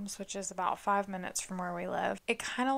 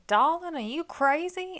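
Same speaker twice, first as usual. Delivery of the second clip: put-on high, loud Charleston accent; shocked homeowner in Charleston accent; 8 semitones higher, 5 dB louder than usual. Same homeowner voice again.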